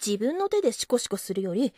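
usual speaking voice

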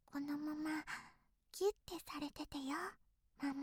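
hushed and close, barely voiced; soft whisper. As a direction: murmur it right at her ear. Hugging mother from behind.

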